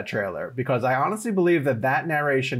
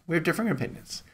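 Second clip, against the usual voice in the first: softer, knowing repeat; pointed; 5 semitones higher, 5 dB quieter, faster than usual. Real, not acted. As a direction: lean on differing, hushed and wry. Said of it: "I'll just leave it at that".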